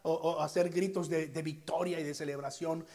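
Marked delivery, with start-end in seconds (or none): none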